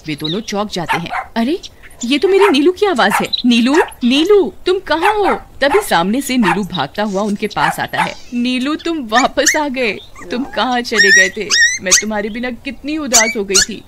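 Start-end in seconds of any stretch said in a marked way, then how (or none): 1.4-5.3 higher, louder eager calling for the dog; excited calling. Acted. Hears lost dog's bark. 8.3-13.8 hug the lost dog with your voice; tender reunion. Acted. Suman greets returned dog.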